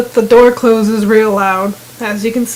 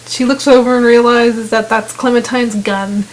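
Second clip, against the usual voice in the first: ordinary talk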